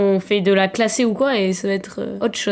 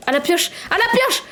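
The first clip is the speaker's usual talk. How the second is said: higher, urgent battle chant; frénésie de jeu; 7 semitones higher than usual. Real, not acted. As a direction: chant it frantically as you attack. Frantic in-game attack with pickaxe. (cri d'attaque surexcité, voix aiguë)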